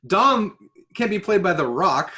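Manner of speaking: usual speaking voice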